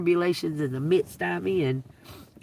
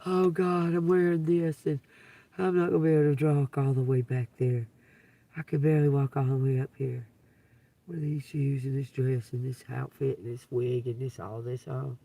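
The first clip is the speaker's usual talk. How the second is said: lightly voiced fretful woman, lower, quick muttering; imitating the lady; 5 semitones lower, 4 dB quieter than usual. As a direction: play her grumbling to herself about her shoes. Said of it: "I think she was doubting herself".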